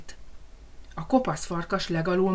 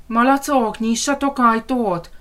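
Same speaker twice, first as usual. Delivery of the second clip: loud, higher wolf demanding entry, slow; 5 semitones higher, 6 dB louder than usual. Acted. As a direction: pound out the order at the door. Wolf banging on the door. (hangos, követelőző farkas)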